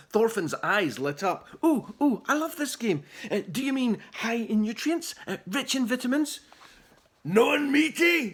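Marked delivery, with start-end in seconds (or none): 1.6-6.4 high, eager guesses. A narrator's reading. Polite boy guesses words for the Vikings, eager. 7.2-8.3 gruff suggestion. A narrator's reading. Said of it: "suggested someone else".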